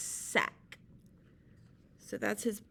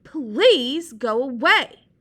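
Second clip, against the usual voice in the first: Sea Slug yelling; 4 semitones higher, 8 dB louder than usual. Acted. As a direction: beg him to go, full volume.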